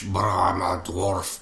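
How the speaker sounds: slow movie-dwarf Scottish accent; lightly put-on Scottish dwarf brogue. Shown as a demonstration. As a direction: draw it out as a Scottish fantasy dwarf. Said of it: "I do the Scottish".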